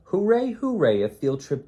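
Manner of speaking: high loud hooray; cheering. Acted. Kids celebrating field trip day, loud high cheer. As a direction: cheer like a thrilled kid.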